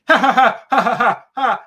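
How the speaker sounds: high, loud put-on cackle of scorn; exaggerated phony ha-ha-ha; loud fake mocking laugh. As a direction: cackle at them to shame them. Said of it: "she gives the".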